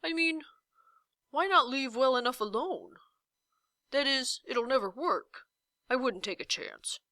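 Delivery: hedging giant voice; can't commit to any word; dithering giant. A narrator's reading. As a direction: indecisive giant hedging every answer.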